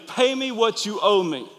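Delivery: retold servant demanding payment. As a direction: squeeze the debtor for the money. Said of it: "grabbed that servant by the throat".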